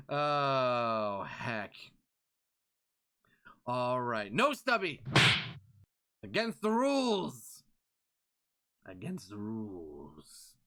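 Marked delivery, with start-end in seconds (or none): 4.3-7.6 louder, higher, scolding the chat; mock stern rule enforcer; firm loud scolding. 8.9-10.5 lower, softer, slow and deep; slow hushed repeat of the rule; low mutter. Acted.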